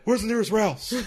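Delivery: high put-on questioning voice; high hammed-up question; mock Austin question. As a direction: ask like a lost host. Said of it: "Austin voiced in bit".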